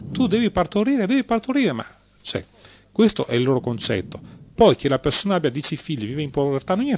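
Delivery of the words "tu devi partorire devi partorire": high, pushy mock decree; scornful mimicry of a divine order to bear children; mock command from God. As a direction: parrot a god ordering the faithful about, much higher. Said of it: "perché Dio dice fa".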